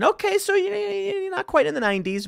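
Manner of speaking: high startled realization; surprised. Real, not acted. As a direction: pitch jumps way up in surprise. Surprised at the release year.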